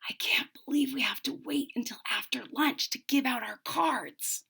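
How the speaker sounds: unvoiced whisper, a little quick. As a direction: whisper it secretly to a friend. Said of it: "Franklin whispered to Bear".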